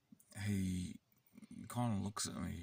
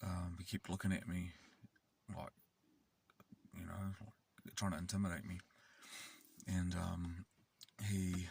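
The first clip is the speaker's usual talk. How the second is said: ordinary delivery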